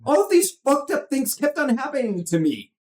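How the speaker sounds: very high-pitched manic comedian imitation; hammed-up coked-up complaint; Robin Williams on coke. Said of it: "that's him, uh, when he, uh, when he's on coke".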